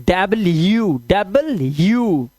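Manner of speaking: slow "double-you" for डबल यू; correct pronunciation. Shown as a demonstration. Teacher modelling the letter's name, correct pronunciation. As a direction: clear y glide closing डब्ल्यू and डबल यू. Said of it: "इसको मिला के बोलते हैं".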